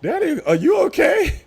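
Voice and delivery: put-on high, small child's voice calling Daddy; little daughter voice. Imitating his small daughter on the intercom, high-pitched. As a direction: sound like a worried little girl. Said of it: "call down on the intercom and say".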